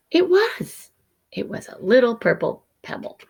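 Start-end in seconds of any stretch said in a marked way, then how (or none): 0.1-0.9 high thrilled gasp then the words; gasping delight. A narrator's reading. Alexander finding the purple pebble, thrilled. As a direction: gasp it out with joy.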